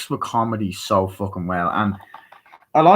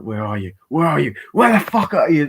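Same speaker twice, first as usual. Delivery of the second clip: acted search getting more exasperated; growing impatience; 6 semitones higher, 4 dB louder than usual. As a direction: build from ordinary call to high, loud yell.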